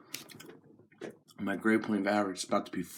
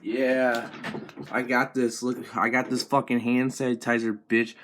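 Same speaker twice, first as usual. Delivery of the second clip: ordinary talk, nothing marked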